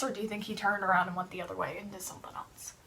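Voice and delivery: soft, fast whispered question. Shown as a demonstration. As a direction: hush and hurry through it. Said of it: "whispered question to kids".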